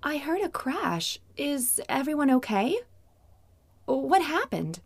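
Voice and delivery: a concerned mother calling; mom voice. Acted.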